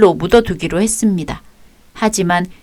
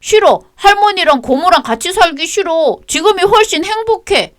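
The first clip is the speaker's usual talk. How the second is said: put-on high, pleading kid's cry of no; child refusing, high; 11 semitones higher than usual. Boy refusing to go with his aunt, high child voice. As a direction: dig in like a scared child.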